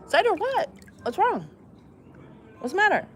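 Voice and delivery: high, fussing pet voice; dog-talk voice. Acted. Checking on the whining dog, high cooing voice. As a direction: coo worried questions at the dog.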